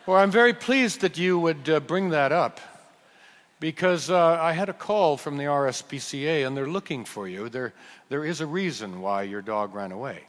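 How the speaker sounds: low-key, lightly put-on British accent; attempted British accent. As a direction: have a go at British small talk, not very convincingly. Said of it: "do something that might be British".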